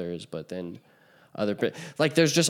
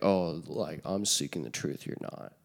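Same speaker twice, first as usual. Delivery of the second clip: low, softer self-parody; mock obnoxious; 4 semitones lower, 5 dB quieter than usual. Parodying how seeking the truth sounds, mock obnoxious. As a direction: imitate someone insufferably superior, lower and quieter. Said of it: "this sounds super obnoxious".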